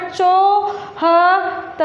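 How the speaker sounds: चौहत्तर drawn out slowly, चौ with no nasal sound; non-nasal chau. Shown as a demonstration. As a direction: stretch चौहत्तर slowly, keeping the चौ clean and not nasal. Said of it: "नाक से sound नहीं कर रहा है".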